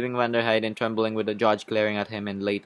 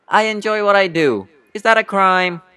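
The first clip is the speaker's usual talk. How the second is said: loud, high, indignant protest; heated witness snapping back; defiant outburst; 8 semitones higher, 8 dB louder than usual. Acted. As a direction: bristle and raise your voice in defense. Witness defends himself hotly.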